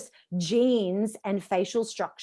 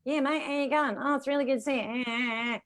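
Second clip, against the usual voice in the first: Aussie bogan; 4 semitones higher than usual. Retold or imitated. Coach imitating Queenslanders, Aussie bogan mumble. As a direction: barely move the mouth, Aussie bogan. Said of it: "don't move their mouth when they speak".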